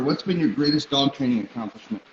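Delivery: ordinary talk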